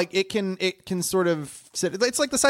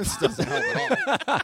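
mock dismay; slower than usual. Kid dismayed reading book.

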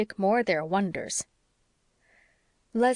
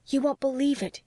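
higher, breathless with excitement; bursting to tell the news; excited; 6 semitones higher than usual. A narrator's reading. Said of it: "she was certainly much excited".

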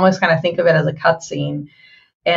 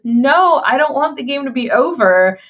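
lightly voiced teen, higher, mock-sad; mock sad whine; 6 semitones higher than usual. Retelling a fan's credits reaction, mock sad. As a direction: play the sad fan not wanting it over. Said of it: "first she starts with".

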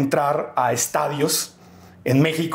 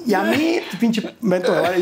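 mocking snobby crowd; 6 semitones higher than usual. Host mocking the rude rich audience, lightly laughing. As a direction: sneer it like a spoiled rich kid, half laughing. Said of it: "O sea, eran como".

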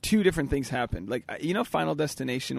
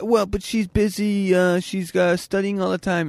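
mock whiny voice; 6 semitones higher, 5 dB louder, slower than usual. Retelling ex's boyfriend excuse.

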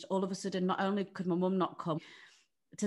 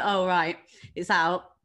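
louder flat deadpan surprise; 8 dB louder, slower than usual. Retold or imitated. Pessary falls out on the toilet, louder stunned deadpan. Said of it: "So I was like".

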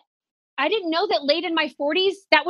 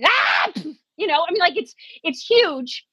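ordinary talk

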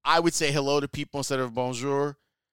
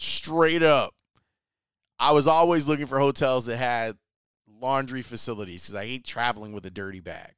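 usual speaking voice

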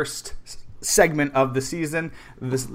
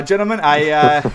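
loud announcer address; 3 semitones higher, 9 dB louder than usual. Pretend. Restarts his mock grand address.

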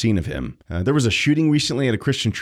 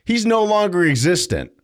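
hammed-up mock quote; mocking the left; 10 semitones higher than usual. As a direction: sneer it like a smug critic.

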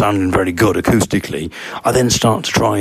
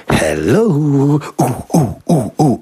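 drawn-out hello rising into high hoots; echo-testing call and oohs; testing the acoustics; 5 semitones higher, slower than usual. Retold or imitated. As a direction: sing out to the wall, then hoot.

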